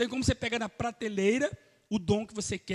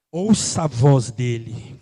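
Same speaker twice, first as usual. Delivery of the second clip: very low, loud and slow; deep solemn command; 11 semitones lower, 9 dB louder, much slower than usual. Real, not acted. Pastor urging the church to listen, deep and slow. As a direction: drop deep and drag each word.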